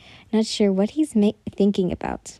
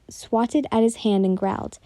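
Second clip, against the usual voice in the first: ordinary delivery, nothing marked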